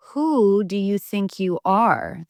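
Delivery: slow, mean hissed challenge; hissing. A narrator's reading. Tom cat hissing at the intruder, slow and mean. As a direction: hiss it like a territorial cat. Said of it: "the tom cat hissed".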